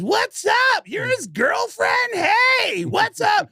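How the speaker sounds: very high shouted greeting; mock high-pitched, drawn-out yelling. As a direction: imitate an overexcited rocker hollering hello. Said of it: "And then [name] goes".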